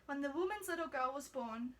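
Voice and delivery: ordinary delivery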